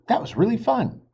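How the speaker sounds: high, quick thrilled thanks; thrilled boy. Acted. Jack thanks his sister after the ride, thrilled. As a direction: gush with relief and joy.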